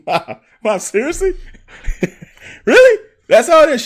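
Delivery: high, loud disbelieving whine, laughing; incredulous protest. Retold or imitated. Son protests to his mom after the game, high disbelieving whine. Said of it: "I'm like".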